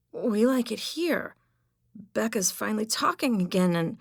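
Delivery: put-on high, pleading boy's voice, straining; desperate high pitch. A narrator's reading. Boy begging the old man to let him stay, desperate high pitch. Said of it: "the desperate high pitch in his voice".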